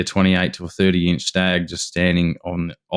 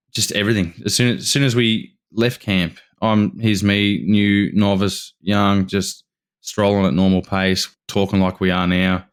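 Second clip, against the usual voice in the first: ordinary delivery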